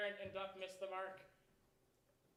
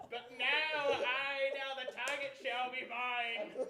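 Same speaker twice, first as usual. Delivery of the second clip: triumphant cry; 2 semitones higher, 8 dB louder than usual. Acted. Pilot triumphantly lining up shot.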